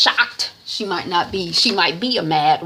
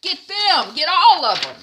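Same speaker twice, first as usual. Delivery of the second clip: high, loud furious command; furious order; 9 semitones higher, 6 dB louder than usual. Retold or imitated. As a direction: order Baba to seize everyone. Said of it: "wifey is like".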